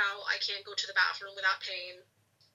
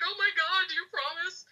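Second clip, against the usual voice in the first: desperate pleading; 10 semitones higher than usual. Retold or imitated. Begging the doctor for reassurance.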